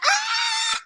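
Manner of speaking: high mock scream of fans; crowd scream. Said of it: "And everybody like".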